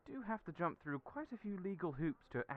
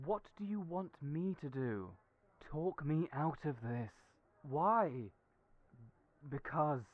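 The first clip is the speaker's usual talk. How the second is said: ordinary delivery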